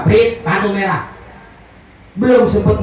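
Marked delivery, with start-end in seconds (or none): 0.0-1.1 drawn-out whistle, then the verdict; mock-angry referee's slow call. Referee punishes repeated fouls, mock angry. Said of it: "Lo wasit marah".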